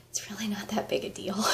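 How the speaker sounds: an unvoiced confiding whisper, mostly breath; whispered aside. Real, not acted. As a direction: drop to a secret whisper, as if letting them in on it. Confiding aside about sign-ups.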